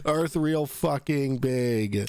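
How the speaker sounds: slow, deliberate sarcasm; slow emphatic. Pretend. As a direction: spell it out very slowly. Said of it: "sarcastic summary".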